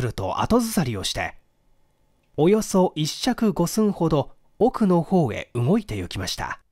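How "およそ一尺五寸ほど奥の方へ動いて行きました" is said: ordinary delivery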